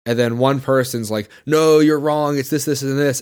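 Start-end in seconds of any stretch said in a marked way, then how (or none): 1.4-3.2 louder, higher mock know-it-all objection; hammed-up contradicting commenter; mock indignant correction. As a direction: imitate a commenter butting in to contradict. Voicing a rude online commenter.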